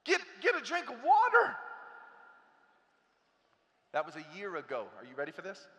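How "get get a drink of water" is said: high, loud order; tough dad. Retold or imitated. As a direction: snap it like a coach on the sideline.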